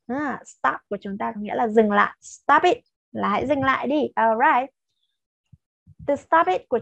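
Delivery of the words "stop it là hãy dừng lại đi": usual speaking voice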